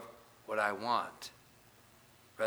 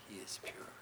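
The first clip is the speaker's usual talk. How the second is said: hushed whisper; 3 semitones lower, 12 dB quieter than usual. Real, not acted. Preacher reading scripture ends it in a reverent whisper. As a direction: whisper the last words, voiceless.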